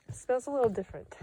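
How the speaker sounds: uneasy remark ending in a small laugh; nervous laugh. Real, not acted. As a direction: let a soft nervous chuckle trail the words. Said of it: "I say that with a nervous laugh".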